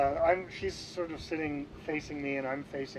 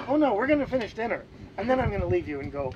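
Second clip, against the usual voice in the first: quick, higher retold protest; hasty reassurance; 5 semitones higher, 4 dB louder, faster than usual. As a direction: start high and loud, rattle off the plan. Retold hasty answer to dinner date.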